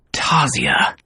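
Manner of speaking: breathy voiceless question; whisper. Acted. Luke whispering the governess's name, stunned.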